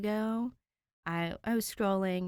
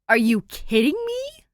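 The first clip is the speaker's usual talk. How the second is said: much higher and loud, disbelieving; incredulous; 8 semitones higher, 12 dB louder than usual. Acted. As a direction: yelp it like you can't believe it. Stunned reaction to reveal.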